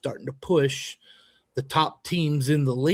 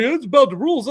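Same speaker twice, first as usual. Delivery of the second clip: rival coach's smug retort, pitched up; imitating the other coach; 9 semitones higher, 6 dB louder, faster than usual. Coach mimics the rival coach at the game, a high mocking voice. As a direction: mimic him with a higher, stiff, superior tone. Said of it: "And he's like".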